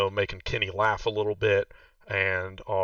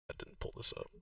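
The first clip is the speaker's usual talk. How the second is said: normal talking voice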